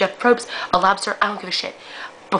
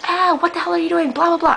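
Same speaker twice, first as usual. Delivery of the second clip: much higher, loud yell at the TV; couch yell; 11 semitones higher, 4 dB louder than usual. Retold or imitated. Yelling at Survivor players from her couch, shouting. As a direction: shout at a contestant making a dumb move. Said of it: "I will yell on the couch".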